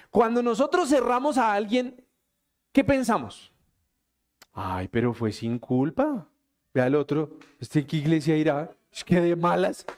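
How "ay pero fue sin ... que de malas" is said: grumbling to oneself. Retold or imitated. Imitating a grumbling driver.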